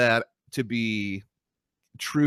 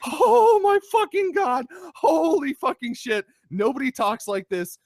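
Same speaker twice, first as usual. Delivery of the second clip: much higher, louder, slow and disbelieving; exasperated outburst; 4 dB louder than usual. Retold or imitated. Fed-up viewer ranting at the show's dialogue, loud mock outrage. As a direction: throw up your hands at bad writing. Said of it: "I was really angry at the dialogue".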